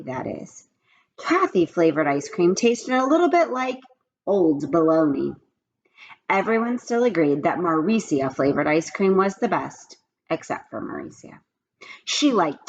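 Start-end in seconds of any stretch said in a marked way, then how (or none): 0.0-0.6 whispered aside. A narrator's reading. Teacher aside. 10.3-11.4 whispered aside. A narrator's reading. Teacher aside.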